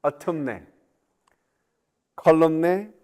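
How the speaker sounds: Autumne and Columne with the final N spoken; silent N sounded. Shown as a demonstration. Teacher mocking silent letters: Autumne with the N sounded. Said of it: "Silent N".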